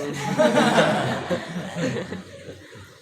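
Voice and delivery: big burst of real laughter; loud laugh. Real, not acted. Tertawa lepas setelah lelucon. (tawa lepas yang keras)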